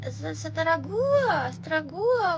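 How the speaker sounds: loud, slow, drawn-out defiance; defiant. Real, not acted. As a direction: stretch it out, sassy and loud.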